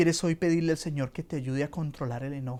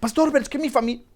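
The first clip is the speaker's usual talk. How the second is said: high, fast, stammering mock believer; whiny excuse-maker; 9 semitones higher, 6 dB louder, much faster than usual. Believer making excuses to the pastor, high and whiny. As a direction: mimic someone pleading excuses to the pastor.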